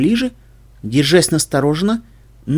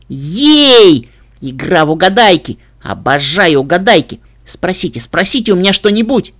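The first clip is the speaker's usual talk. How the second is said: louder, bouncing with glee; excited filly; 3 semitones higher, 5 dB louder than usual. Acted. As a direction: squeal it like a hyper child. Filly thrilled by a game.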